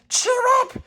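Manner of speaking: very high, bright call to cheer them; cheerful cry. A narrator's reading. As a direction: clown calls out to sad toys, high and cheerful. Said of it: "cried the little toy clown".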